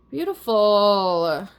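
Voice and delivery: slow, drawn-out "Beautiful" ending on a rising lilt. Real, not acted. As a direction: stretch "Beautiful" out slowly, rising at the end. Savoring the reading's final card.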